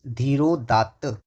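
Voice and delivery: धीरोदात्त stretched out slowly, syllable by syllable; slow repeat. Shown as a demonstration. Teacher repeating धीरोदात्त slowly for the students. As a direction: draw out धीरोदात्त slowly so the class can follow. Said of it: "क्या कहेंगे उसको हम?".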